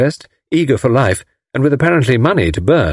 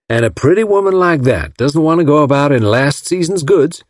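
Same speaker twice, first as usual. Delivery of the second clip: put-on Southern American accent; 5 semitones higher than usual. A narrator's reading. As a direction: gush like a smitten Southern gentleman. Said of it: "Mister Ryder came from Alabama".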